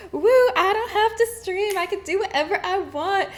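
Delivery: mock carefree joy at freedom; carefree glee. Retelling her break start, gleeful. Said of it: "I was just like".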